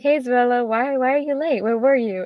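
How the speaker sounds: a hint of a puzzled classmate asking why she's late; friend asking. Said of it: "he was like".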